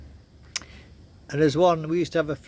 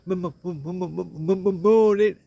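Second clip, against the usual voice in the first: imitating a stutter; 3 semitones higher than usual. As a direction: stumble over the m like the stuttering coworker. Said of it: "he used to stutter".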